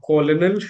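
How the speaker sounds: "colonel" read as spelled, "co-lo-nel"; spelling pronunciation of "colonel", three syllables; old misreading. Shown as a demonstration. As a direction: say "colonel" with both L's and no R. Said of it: "I used to read".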